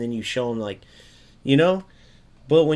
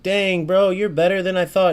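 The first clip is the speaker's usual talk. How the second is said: higher and louder, a playful concession; mock impressed; 7 semitones higher, 5 dB louder than usual. Streamer pretending to be impressed by the opponent, mock surprise. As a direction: admit it with fake amazement. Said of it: "either I'm like".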